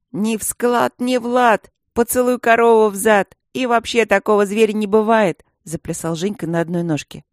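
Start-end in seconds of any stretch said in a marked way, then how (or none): 0.1-5.5 громко и высоко, задиристой скороговоркой; выкрикивай стишок, дразня девчонку; мальчишеская дразнилка. A narrator's reading. Женька дразнится стишком, приплясывая. (звонкое ритмичное поддразнивание)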